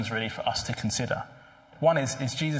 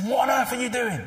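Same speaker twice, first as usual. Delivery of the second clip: high, sharp trainer snapping at his boxer; sharp snap; 11 semitones higher than usual. Retold or imitated. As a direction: bark it at a slacking fighter. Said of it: "Apollo Creed just snaps at him".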